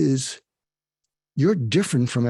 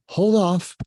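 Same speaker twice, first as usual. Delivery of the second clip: calm firm hold off; 5 semitones higher than usual. Shown as a demonstration. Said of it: "in a non-aggressive way".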